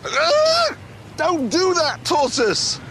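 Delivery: sudden high alarmed cry; startled shout. Real, not acted. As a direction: jump at the tortoise in the road. Tortoise suddenly in the road.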